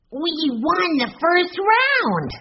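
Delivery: high, drawn-out gleeful cry; excited child's cheer. A narrator's reading. Benny celebrates the gold coin find, high excited cheer. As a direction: crow it like a thrilled little boy.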